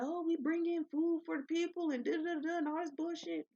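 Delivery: mocking high sing-song; mocking quote. Worker mocking the company's food promise, high mocking tone. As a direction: sneer at their fake excuse. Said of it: "they just make it seem like".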